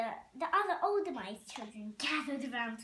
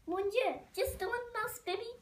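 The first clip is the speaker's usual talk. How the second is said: higher dismayed French mouse; French accent; 8 semitones higher than usual. Acted. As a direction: Mon Dieu in a theatrical French accent. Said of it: "A, a French accent".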